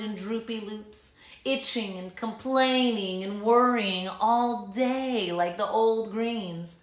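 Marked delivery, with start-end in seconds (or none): none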